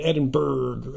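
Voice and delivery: American "Edinburgh", hard final G; Californian pronunciation. Shown as a demonstration. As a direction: say "Edinburgh" as EDIN-BURG.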